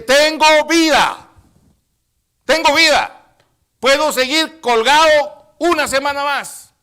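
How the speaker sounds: very loud, high, drawn-out cry; defiant shout. Acted. Jesus on the cross proving he still has life, full shout. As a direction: bellow it to everyone around, full voice. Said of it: "Él grita y dice".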